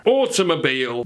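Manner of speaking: put-on British "automobile"; British accent. Shown as a demonstration. Quickfire round for car, mock British accent. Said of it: "how to pronounce it".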